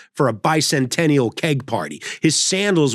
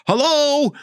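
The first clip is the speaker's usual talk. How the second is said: put-on old man answering; 11 semitones higher than usual. Elderly driver answering again.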